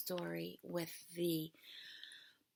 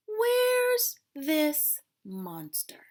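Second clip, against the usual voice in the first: hedgehog; 6 semitones higher, 6 dB louder, much slower than usual. Acted. Hedgehog demanding.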